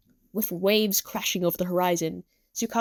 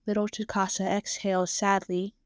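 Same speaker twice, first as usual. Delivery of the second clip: ordinary delivery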